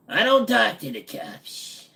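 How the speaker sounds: high-pitched, drawn-out character voice; mock indignant reply. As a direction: answer slowly in a squeaky put-on voice. Acted reply to question about cops.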